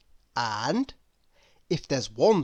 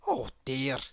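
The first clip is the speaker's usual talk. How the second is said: sighing; 5 semitones lower, 6 dB quieter than usual. Acted. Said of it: "he sighed to himself".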